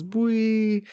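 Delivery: high, long "bwee"; imitated bounce noise. Reader mimics Nami bouncing off the cat, high squealed noise. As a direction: squeal it upward and hold it.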